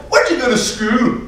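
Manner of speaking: high, slow Irish question; hammed-up Irish curiosity; Irish accent. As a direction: ask in a drawn-out Irish lilt. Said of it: "a little bit of an Irish accent".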